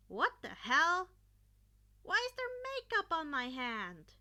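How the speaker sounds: yelling. Acted. Bully yells at Izuku over makeup on his hand, loud and shocked. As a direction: bark it out in disgust. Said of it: "The one on the right to Kacchan yelled".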